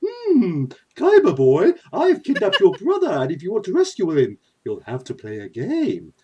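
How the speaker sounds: high, swooping theatrical villain; Pegasus Maximilian. Acted. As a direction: gush and swoop, hammed-up. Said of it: "the wonderful Pegasus Maximilian".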